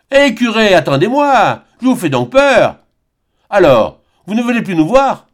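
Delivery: loud, high, mocking country uncle calling out; jovial peasant hail. A narrator's reading. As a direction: call across the road to the nervous priest. Jean Bernat interpelle le curé. (l'oncle interpelle le curé, fort et goguenard)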